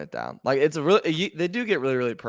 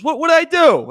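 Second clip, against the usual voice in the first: 2 high, loud fake-innocent protest; 3 play dumb, loudly, way up high; 1 mock innocent; 11 dB louder than usual. 4 host mimics Rivers pretending he did nothing wrong, high and loud. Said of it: "acting like he didn't do anything".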